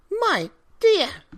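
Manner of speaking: put-on very high, loud old woman's cry. A narrator's reading. Old wife cries out at husband, high alarmed. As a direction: call out startled as the old woman. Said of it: "she cried".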